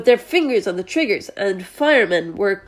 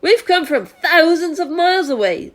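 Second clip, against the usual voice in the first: higher, louder grinning reply; shouting back; 7 semitones higher, 3 dB louder than usual. A narrator's reading. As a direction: call it down cheerfully. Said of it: "the centipede shouted back".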